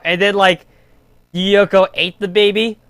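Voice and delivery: usual speaking voice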